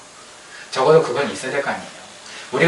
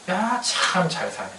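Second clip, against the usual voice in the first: drawn-out mock admiration, higher and softer; sarcastic; 5 semitones higher, 5 dB quieter, much slower than usual. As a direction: say it slowly, dripping with irony. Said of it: "비꼬으신 거거든요".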